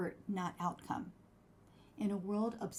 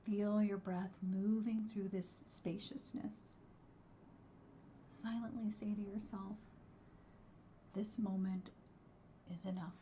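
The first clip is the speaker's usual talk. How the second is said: soft spa whisper; 7 dB quieter than usual. Acted. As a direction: ease them into calm, softly. Leads a gratitude meditation.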